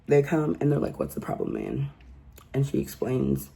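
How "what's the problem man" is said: deep, lowered mock cop voice. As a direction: drop way down like a bored cop. Cops arriving, quoted by speaker.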